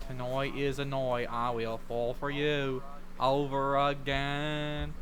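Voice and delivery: put-on Australian accent. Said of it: "what accent is, like, noi?".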